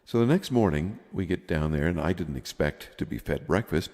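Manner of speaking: ordinary talk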